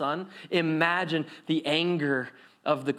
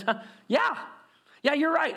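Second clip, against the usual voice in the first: higher, knowing yes you're right; agreeing Jesus; 9 semitones higher than usual. Acted. Jesus agreeing with the crowd, lightly voiced. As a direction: concede the point with a wink. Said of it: "Jesus goes on, he says".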